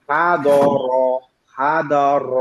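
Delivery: হা দ র with a trilled র; rolling r. Shown as a demonstration. Teacher drills the rolled র for learners. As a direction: trill the র at the end of হা দ র. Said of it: "যত rolling করতে পারবেন তত ভালো".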